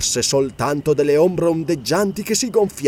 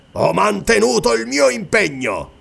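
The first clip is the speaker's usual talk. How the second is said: higher-pitched angry growl; growled retort; 7 semitones higher than usual. A narrator's reading. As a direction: growl it out in anger. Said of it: "ringhiò". (ringhio rabbioso)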